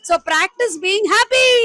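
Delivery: very slow, loud, every word stretched; slow motto. Real, not acted. Guest ending her speech to the crowd, slow and loud. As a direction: land the closing line like a slogan.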